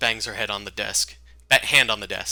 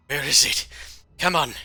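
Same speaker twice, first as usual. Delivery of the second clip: higher, louder agitated self-talk; impatient searching; 6 semitones higher, 6 dB louder than usual. Acted. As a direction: get more desperate as you search. Search grows more desperate.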